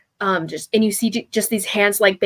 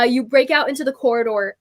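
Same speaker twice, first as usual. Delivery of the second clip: ordinary delivery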